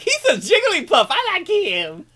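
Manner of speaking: high, loud, simple-minded glee; mock yourself as an excitable simpleton; childlike enthusiasm. Host mocking his own lack of reasons, childlike excitement.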